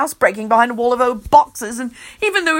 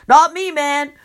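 announcing; 6 semitones higher, 4 dB louder than usual. A narrator's reading. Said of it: "Kenny announces".

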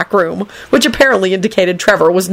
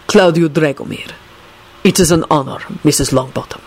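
lightly voiced formal gentleman; courtly gentleman. A narrator's reading. Dragomir introduces himself to Alice, courtly and polite. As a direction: introduce yourself with measured courtesy.

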